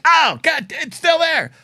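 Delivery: high, startled "Oh, God"; mock dismay. Finding glass months later, high startled dismay. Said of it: "you're like".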